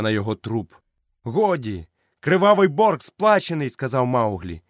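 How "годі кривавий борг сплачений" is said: triumphant call. Acted. Mowgli ends the fight.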